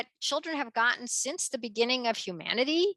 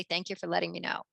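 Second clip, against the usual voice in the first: calm soft reply; 5 semitones lower, 6 dB quieter, faster than usual. Retold or imitated. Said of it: "and you say".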